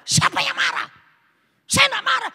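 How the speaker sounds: loud, very high-pitched acted indignation; angry denial. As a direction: protest shrilly while denying any anger. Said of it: "Bapak marah lah". (pura-pura membantah dengan marah, melengking)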